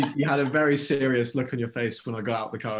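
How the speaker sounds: ordinary talk, nothing marked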